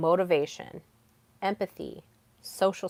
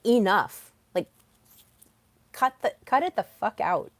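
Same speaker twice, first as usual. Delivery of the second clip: louder, forceful quoted order; imitated firm command; 2 semitones higher, 5 dB louder than usual. Her king interrupting her victim spiral, imitated firm command. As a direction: put your foot down, retelling it. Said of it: "when my king came in and was just like".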